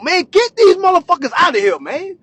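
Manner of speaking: worked-up loud demand; egging them on. Acted. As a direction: rile up the room to throw them out. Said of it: "like, egging that shit on".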